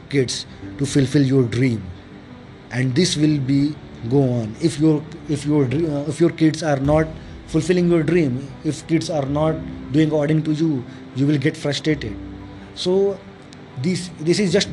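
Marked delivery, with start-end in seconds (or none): none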